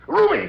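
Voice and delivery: horrified cry as she falls; screaming as she falls; anguished scream. Acted.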